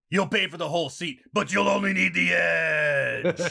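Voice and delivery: shouted hyped ad read; monster truck ad. Acted. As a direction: yell it like a rally promo. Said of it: "talk like monster truck rally commercials".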